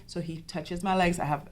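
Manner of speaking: usual speaking voice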